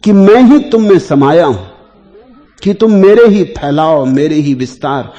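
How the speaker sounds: a hint of God calling to the devotee. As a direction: declare it as God, loud and high, then easing. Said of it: "तुम्हें परमात्मा की ये आवाज सुनाई पड़ने लगेगी".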